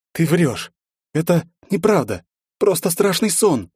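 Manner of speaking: cling to it being a dream; desperate denial. A narrator's reading. Героиня в отчаянии отрицает. (испуганное отрицание)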